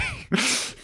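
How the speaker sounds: quick high giggle; high laugh. Real, not acted. Laughing to cohost about the trailer list, high giggle. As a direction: burst into a short high laugh. Laughs after Barbie mention.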